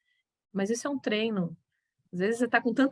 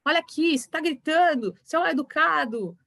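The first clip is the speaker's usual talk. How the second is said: scolding; 6 semitones higher than usual. Retold or imitated. Teacher scolding the class, raised voice. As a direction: snap at the noisy pupil. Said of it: "chamar atenção e falar assim".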